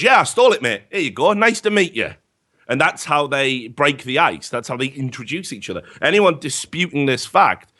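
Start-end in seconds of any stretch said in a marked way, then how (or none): 0.0-2.1 high, bright, cheery confession; cheerful thief. Retold or imitated. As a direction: hand it over like a friendly greeting. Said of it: "And he goes".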